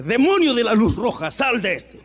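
loud, high mock exorcism shout, laughing; booming mock deliverance cry with a laugh; mock exorcist. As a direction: ham up a preacher casting out a demon. Mocking a preacher's exorcism.

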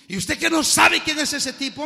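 put-on loud, high incredulous young man; a loud scoffing street kid; scoffing stranger. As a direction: sneer it in disbelief. Stranger voiced in sermon story.

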